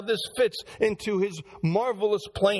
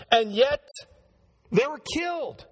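ordinary talk

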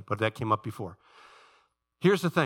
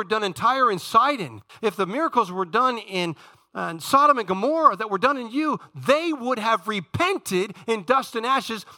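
high, driving preacher's delivery; impassioned preaching; 7 semitones higher, 4 dB louder than usual. Real, not acted. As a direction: build the argument forcefully from the pulpit. Preaches at full force.

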